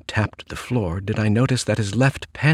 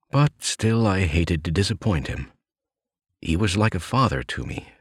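plain reading voice

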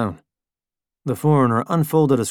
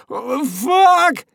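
much higher, loud alarm; panicked shout; 5 dB louder than usual. A narrator's reading. Crash begins.

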